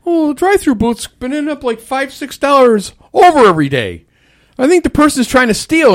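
high, worried boss voice; manager voice. Retold or imitated. Worker imitating managers suspecting theft, high voice.

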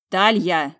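loud, exasperated name call; ready to yell. A narrator's reading. Blocked by her sister, exasperated. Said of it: "а сейчас кричать была готова".

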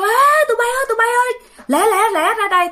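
high urgent shout across the water; calling for help. Retold or imitated. Dong shouting to friends to help pull Hùng, urgent call. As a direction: yell at the friends to hurry over. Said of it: "bạn mới kêu".

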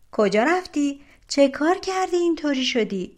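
high-pitched, pressing questions; jealous questioning. A narrator's reading. Jealous second sister questions the first, high and eager.

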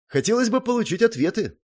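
high-pitched, bewildered request; puzzled. A narrator's reading. Hero asking the gnome for answers, puzzled. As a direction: say it as if it were obvious, puzzled. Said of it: "недоумённо озвучил я".

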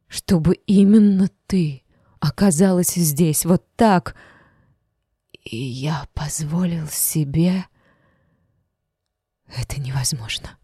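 breathless, disbelieving whisper; barely voiced, stunned; shocked whisper. Shocked samurai muttering.

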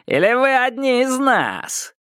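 high sneering madman voice; taunting crazy. Acted. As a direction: taunt like an unhinged stranger. Crazed onlookers taunting the group. (издевательский голос безумца)

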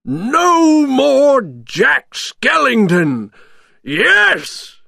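very high, loud gloating; gleeful, stretched villain shout; Oogie Boogie shouting happily. A narrator's reading. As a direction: cheer your rival's downfall. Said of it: "he shouted happily".

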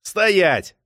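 explosive order to stop; yelled command. A narrator's reading. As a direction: halt him with a shout. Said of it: "заорал Артур".

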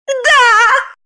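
very high shrill cry; shouted. A narrator's reading. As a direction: yell the answer in a high woman's voice. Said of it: "закричала Загогулина". (высокий крик)

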